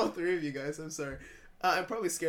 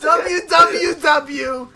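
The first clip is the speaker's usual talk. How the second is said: put-on very slow, loud New York accent; 7 semitones higher, 11 dB louder, much slower than usual. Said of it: "I was trying to do a New York accent".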